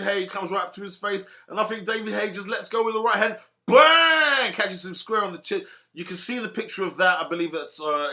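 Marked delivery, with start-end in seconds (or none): 3.7-4.5 much higher and louder, punchy exclamation; loud excited bang; punch sound word. Pretend. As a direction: snap it out like the blow lands. Describing the punch landing.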